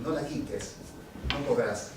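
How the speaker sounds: low, soft poetic line; soft recitation. Acted. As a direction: say it quietly, letting it settle. Poem line first said softly.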